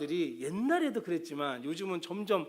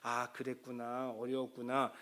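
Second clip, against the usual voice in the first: softer, slow and comforting; soothing empathy; 3 semitones lower, 6 dB quieter, slower than usual. Retold or imitated. Parent comforting an upset child, soft and slow. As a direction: coo it like a patient counselor. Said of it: "이렇게 공감을 해주라는 거죠".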